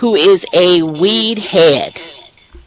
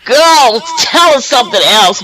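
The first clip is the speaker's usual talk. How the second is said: louder, higher mock heckle; playful heckle from the audience, loud and higher; impatient audience shout; 7 semitones higher, 5 dB louder than usual. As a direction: call out like a bored fan at the show. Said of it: "you guys are probably going".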